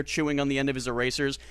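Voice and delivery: ordinary talk, nothing marked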